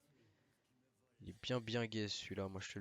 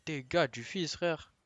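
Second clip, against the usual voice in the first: higher, louder outraged complaint; outraged gamer; 8 semitones higher, 11 dB louder, slower than usual. Real, not acted. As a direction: complain loudly in disbelief. Again outraged.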